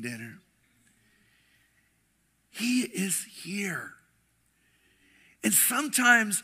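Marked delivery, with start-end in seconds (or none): 2.5-3.9 slow hushed whisper; reverent whisper. Acted. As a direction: breathe it in awe. Sensing God's presence at home.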